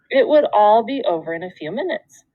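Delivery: ordinary delivery, nothing marked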